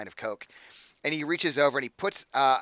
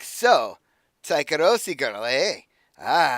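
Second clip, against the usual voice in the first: louder, leering put-on accent; accented villain voice; 2 semitones higher, 7 dB louder, slower than usual. Patron leering at the Taikaroshi girl in a put-on accent. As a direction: savour the words like a creep. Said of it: "I love the accent".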